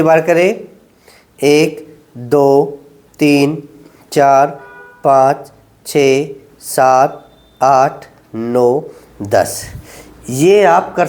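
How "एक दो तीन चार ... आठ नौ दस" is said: low, drawn-out counting through the hold; slow held count. Shown as a demonstration. Physio to patients, counting out a slow ten-second hold. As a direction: count each second slowly while holding the stretch.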